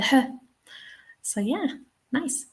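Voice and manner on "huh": h said as a short "huh"; isolated H sound. Shown as a demonstration. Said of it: "we can hear the h".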